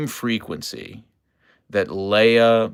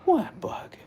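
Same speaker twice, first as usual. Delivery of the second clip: whisper; 5 dB quieter than usual. Real, not acted.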